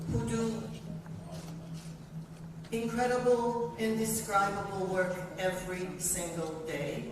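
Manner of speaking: usual speaking voice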